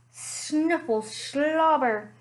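ordinary delivery, nothing marked

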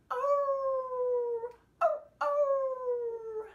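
high, loud wolf howl. Shown as a demonstration. Storyteller howling together with the children, loud high howl. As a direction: howl at the moon like a dog. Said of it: "Let's all howl together".

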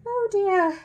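high, loud dismayed cry; dismayed lady. Acted. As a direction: sigh it in dismay. Mrs Brown dismayed.

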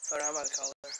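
ordinary talk, nothing marked

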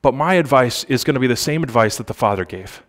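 normal talking voice

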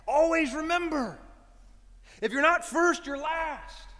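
high, loud call across a busy street; shouting. Retold or imitated. Son shouting after his departing dad across traffic, high and loud. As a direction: yell it after a dad who is walking away. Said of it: "Ricky Bobby shouts to his dad".

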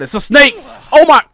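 sudden high yelp of fright; real startled shriek; startled scream. Real, not acted. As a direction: jump out of your skin at a snake. Snake suddenly attacks player.

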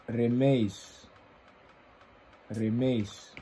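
Remees said slowly and carefully, falling at the end; tentative name pronunciation. Real, not acted. Reactor unsure how to say the artist's name, tentative pronunciation of [name]. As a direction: try out the name Remees, each time with a falling close. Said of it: "Moet zijn naam zo uitspreken".

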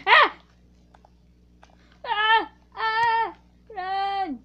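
high loud screams then run; panicked screams. Real, not acted. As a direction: yelp and flee. Fleeing attack in game.

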